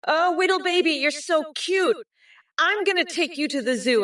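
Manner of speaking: put-on baby talk. Cooing to a baby.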